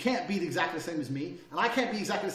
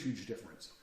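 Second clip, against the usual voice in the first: ordinary talk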